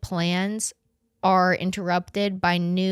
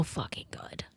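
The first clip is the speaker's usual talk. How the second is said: hushed, breathy confession; whisper; 17 dB quieter than usual. Real, not acted. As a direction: whisper it like a guilty secret. Guilty-pleasure confession.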